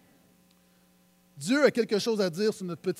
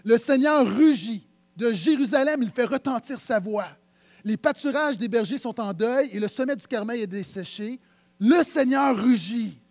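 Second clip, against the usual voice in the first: loud, weighty verse reading; declaimed scripture; 5 dB louder than usual. Acted. Preacher giving the lion-like roar of the Lord, declaimed. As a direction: proclaim it, loud on the roaring Lord.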